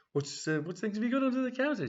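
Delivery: pry with naughty delight; eager customer. Acted. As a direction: Brenda fishing about the hidden goods, high and eager. Brenda asks about hidden specials.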